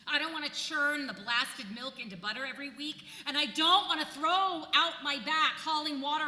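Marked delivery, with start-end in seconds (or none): none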